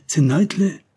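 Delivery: high, loud recognition; astonished cry. A narrator's reading. Tessin recognizing his lost comrade, astonished cry. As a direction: exclaim the name in shock. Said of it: "proclama-t-il".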